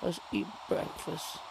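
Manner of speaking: slow breathy whisper; hushed voice. Real, not acted. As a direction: whisper it slowly. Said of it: "Sorry about my low voice today".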